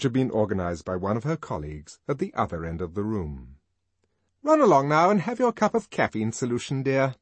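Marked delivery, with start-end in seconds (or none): 4.4-7.1 higher, louder, briskly bossy; brisk nurse. A narrator's reading. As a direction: shoo her colleague off. Nurse dismisses a colleague.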